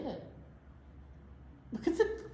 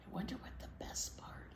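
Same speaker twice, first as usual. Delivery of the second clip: a hushed whisper, secret-sharing; 3 semitones higher, 14 dB quieter, faster than usual. Acted. Teacher whispering an aside to the kids. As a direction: lean in and whisper it.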